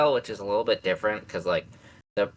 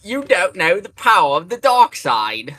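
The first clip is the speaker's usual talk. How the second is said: loud, higher cheesy British villain voice; cheesy British Vader; 8 semitones higher, 9 dB louder than usual. Retold or imitated. Retelling the original Vader actor's take, cheesy British accent. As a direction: imitate a silly British actor playing Vader. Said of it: "he just talks exactly like that".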